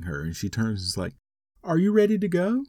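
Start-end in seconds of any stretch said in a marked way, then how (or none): 1.6-2.6 oblivious wife. Retold or imitated. Wife voiced, unaware of everything.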